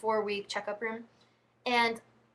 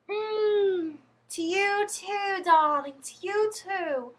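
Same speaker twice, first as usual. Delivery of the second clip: put-on high, slow, drawn-out English accent; mock England accent; 9 semitones higher, 3 dB louder, much slower than usual. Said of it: "that whole, like, England accent too".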